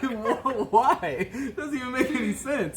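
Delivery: high, squeaky laughing "why?"; laughing disbelief. Retold or imitated. To a drunk friend reversing the car, laughing disbelief. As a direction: laugh through the words in disbelief.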